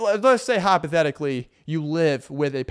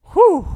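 relieved whew; 13 dB louder than usual. Real, not acted. Exhale after bit.

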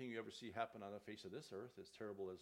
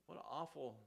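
normal talking voice

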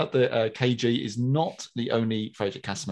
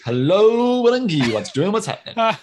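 hammed-up greeting; 9 semitones higher, 7 dB louder than usual. Podcast host reading out a listener's greeting, hammed-up and loud. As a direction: call it out in playful exaggeration.

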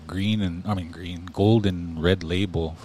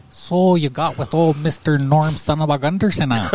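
mock Midwest accent; 6 semitones higher, 4 dB louder than usual. Teasing in Fargo character voice.

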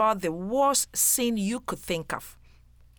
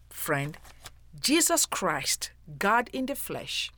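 usual speaking voice

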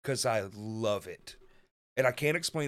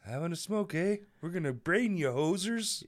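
higher, hammed-up Canadian accent; mock Canadian accent; 5 semitones higher than usual. Playing threatening Canadians from friend's story, mock Canadian accent. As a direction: put on a cartoon Canadian hoser. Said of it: "They're like".

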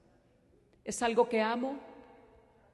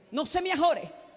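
no se me ajore called out loud and high; preached warning; 5 semitones higher, 5 dB louder, slower than usual. Acted. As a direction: call out no se me ajore. Repeated, preached. (no se me ajore gritado y agudo)

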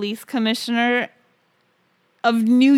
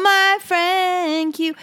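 drawn-out, higher, relishing; savouring title; 8 semitones higher, much slower than usual. Real, not acted. Repeating the joke movie title, slow and high. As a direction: stretch the title slowly, higher.

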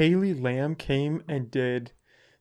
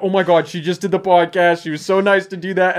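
louder, panicky rush of words; reliving the moment the guest's dog went missing; mild retold panic; 4 semitones higher, 6 dB louder than usual. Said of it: "I was losing my shit".

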